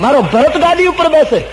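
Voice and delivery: high, loud, fervent declaration; impassioned, selfless declaration; exultant brother Ram. Acted. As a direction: proclaim it with the voice lifted high. Voices Ram rejoicing for Bharat.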